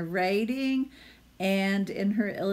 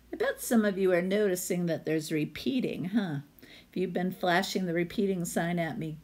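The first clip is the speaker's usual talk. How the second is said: ordinary delivery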